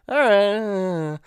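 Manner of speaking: high, drawn-out doubtful "all right"; skeptical reaction. Acted. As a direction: stretch it out, reluctantly going along. Skeptical reaction to film's backstory.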